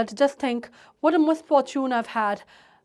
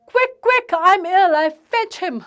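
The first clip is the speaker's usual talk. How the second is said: frantic plea; 3 semitones higher, 5 dB louder, much slower than usual. Acted. Hysterical daughter begs.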